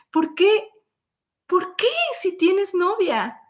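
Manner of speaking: high, rising disbelieving questions; indignant disbelief. Retold or imitated. A woman asking the man who courted her, in disbelief. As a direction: pile up the questions, stunned and hurt. Confronting boyfriend about his girlfriend.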